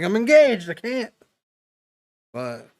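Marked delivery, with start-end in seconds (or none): 0.0-1.1 high, loud flustered refusal. Retold or imitated. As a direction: back off, flustered, pitched up. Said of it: "I was like".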